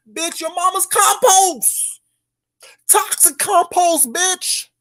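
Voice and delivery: slow high mocking.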